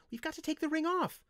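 very high, urgent little voice; urgent hissed protest. A narrator's reading. Windling urging removal of the ring, urgently. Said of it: "Linthus hissed".

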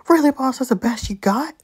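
high, loud, scornful challenge; incredulous. Acted. Locked-in captive taunting the boss, loudly incredulous. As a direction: throw it out in loud disbelief.

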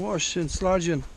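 ordinary delivery

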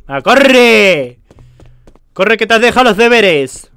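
loud frantic yelling; panicked shout. Acted. Rushing the player along, in a loud panic. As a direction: shout in a panic, like you forgot something vital.

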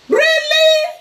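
shrill squeal of disbelief, high and loud; incredulous. Real, not acted. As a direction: fling the word up in outrage. Outraged at a rumour.

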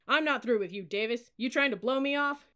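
loud, confrontational challenge; berating. A narrator's reading. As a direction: get in the cadet's face. Cadet leader berating a new cadet.

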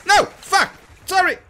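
high, loud, flustered cursing and apology; panicked apology. Real, not acted. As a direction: own up in a panic.

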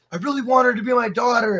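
earnest talk-show confession, retold; imitated heartfelt plea. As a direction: act out a man pouring his heart out. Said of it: "pouring his heart out".

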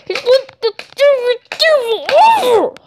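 high, slow, ominous; brand Diesel the devil; dramatic accusation. Pretend. Thomas telling police Diesel killed the puppy, dramatic.

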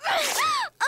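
very high, excited gasp; swooning gasp. Acted. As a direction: gasp in high-pitched rapture. Nurse swoons over Ricky.

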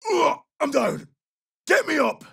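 high, strained cry for help; downed soldier's plea. Acted. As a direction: call urgently to teammates while hurt.

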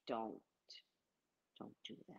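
very soft, barely audible; restrained warning. Retold or imitated. Customer holding back before a clerk, hushed warning. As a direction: nearly swallow the words, pausing after the first.

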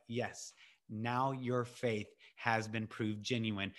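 slow, low and soft; quiet approval. Acted. The Lord answers David's testimony, quiet and slow. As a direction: affirm it gently, taking your time.

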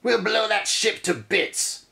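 high, slow gruff pirate threat. Acted. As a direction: growl it like a pirate. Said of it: "yells Red Eye".

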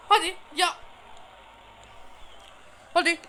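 usual speaking voice

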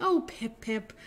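usual speaking voice

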